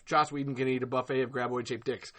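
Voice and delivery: normal talking voice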